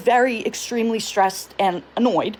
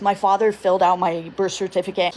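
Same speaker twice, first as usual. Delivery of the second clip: usual speaking voice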